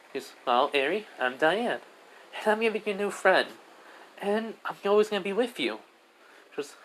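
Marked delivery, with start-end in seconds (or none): 0.4-5.8 put-on high little-girl voice; high-pitched, friendly kid promise; friendly little girl Diane. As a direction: befriend someone as a small child.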